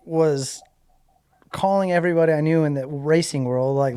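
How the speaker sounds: ordinary delivery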